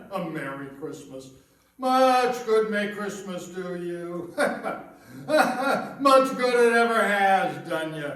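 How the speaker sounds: slow, sneering laughter through the words; mocking laugh. Miser laughing in scorn at his nephew's cheerful wish.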